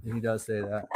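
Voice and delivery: normal talking voice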